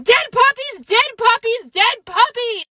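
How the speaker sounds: high, repeated exasperated yell; mock yelling. Dentist to room full of laughing people, high mock yelling. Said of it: "who just kept yelling".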